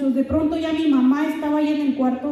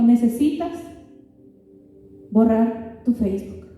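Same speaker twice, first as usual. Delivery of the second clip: lightly measured, slowing toward the end; quoting God verbatim; 4 semitones lower than usual. Retold or imitated. Telling listeners exactly what God told her, measured quoting. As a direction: relay it word by word, slowing and dropping lower. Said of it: "te lo voy a decir así como me lo dijo".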